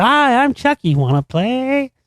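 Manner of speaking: high, sing-song imitation of the Chucky doll. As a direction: chirp it like a creepy talking doll. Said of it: "from the perspective of he's Chucky".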